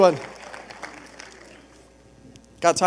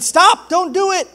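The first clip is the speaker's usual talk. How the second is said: high, loud plea to halt; urgent shout; 10 semitones higher, 8 dB louder, slower than usual. Acted. Man running to a jumper on a bridge, urgent shout. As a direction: call out urgently to stop someone.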